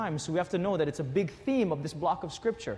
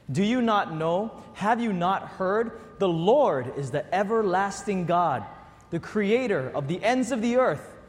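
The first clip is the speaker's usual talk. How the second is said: reading scripture aloud; 3 semitones higher, 3 dB louder, slower than usual. Real, not acted. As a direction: read it together slowly and strongly. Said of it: "Let's read this together".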